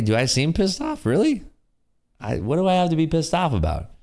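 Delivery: high-pitched, laughing disbelief; baffled, voice shooting up high; incredulous protest. Real, not acted. Chat says he seems pissed off.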